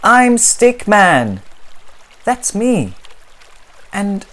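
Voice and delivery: high, loud pleading protest; exasperated little stick figure; frustrated Stickman. Acted. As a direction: insist on who you are.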